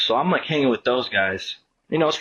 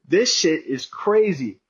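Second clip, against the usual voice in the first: slow, higher, emphatic disbelief; retold amazement; 5 semitones higher, slower than usual. Recalling his reaction to the brawl, slow amazement. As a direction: draw each word out in retold awe.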